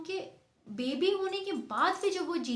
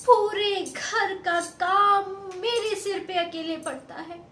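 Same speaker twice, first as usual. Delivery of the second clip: loud, raised, aggrieved outpouring; loud complaint; 3 semitones higher, 7 dB louder than usual. Acted. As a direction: pour out every grievance at her mother. Daughter complains loudly to mother. (ऊँची आवाज़ में नाराज़ शिकायत)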